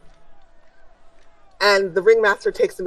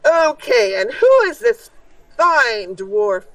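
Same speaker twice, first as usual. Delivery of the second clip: lightly voiced master of ceremonies, pitched up at first; higher opening, announcing with mild flourish; showman ringmaster; 4 semitones higher, 4 dB louder, slower than usual. Ringmaster presenting a contestant to the crowd, lightly showy.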